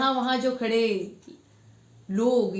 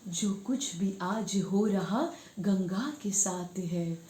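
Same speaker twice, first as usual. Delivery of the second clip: lilting verse, repeats lifted with more force; poetry recitation; 3 semitones higher, 5 dB louder, slower than usual. Acted. Ending the Ganga poem for viewers, emphatic recitation. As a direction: declaim the closing couplets with stretched words.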